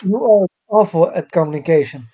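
ordinary talk

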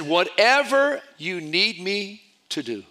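each word of "Whatever you need me to do" spaced out, slow and earnest; slow deliberate repeat. Retold or imitated. Repeating his offer to the stunned pastor, slow and deliberate. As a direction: spell it out slowly for him.

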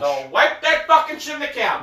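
dad panicking over the couch; panicked yell. Real, not acted. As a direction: shriek to stop him in time. Dad yelling about the couch.

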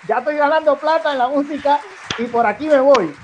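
high, loud boastful voice; cocky self-quote. Retold or imitated. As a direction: brag like a new star. (voz fanfarrona)

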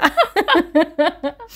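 high real giggling; amused storyteller laughing; giggle. Real, not acted. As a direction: laugh at your own story. Laughing at retold scene.

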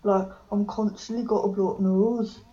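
blocked-nose Birmingham impression; Birmingham accent. Shown as a demonstration. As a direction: do Brummie as if your nose is blocked. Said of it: "that was a really good impression".

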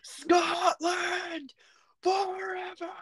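very high, drawn-out Scottish battle cry; Scottish accent. Acted. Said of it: "like, Scottish accent".

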